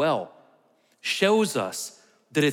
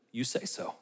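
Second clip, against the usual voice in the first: quiet Jesus; 3 semitones lower, 10 dB quieter than usual. Acted. Jesus quoted answering Pilate.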